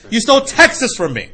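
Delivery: high and loud, hammed-up grievance; mock angry accusation. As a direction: throw the accusation back at him. Angry car owner.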